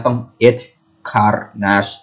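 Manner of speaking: every sound in "H Carnage" pronounced, final consonants released clearly; ออกเสียงครบทุกตัว. Shown as a demonstration. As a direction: say "H Carnage" in separate, fully articulated pieces. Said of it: "ต้องออกเสียงให้ครบทุกตัว ทอทหารก็ต้องออกเสียงให้ชัด". (ออกเสียง "H Carnage" ให้ชัดครบทุกตัว)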